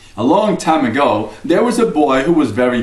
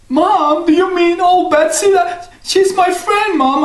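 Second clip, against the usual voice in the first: put-on high, slowing boy's plea; pleading boy. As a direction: beg to keep your friend. Jack pleading for his cow.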